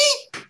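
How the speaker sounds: tiny high mouse squeak; a sudden soft squeak of fright. Acted. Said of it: "causing her to squeak softly".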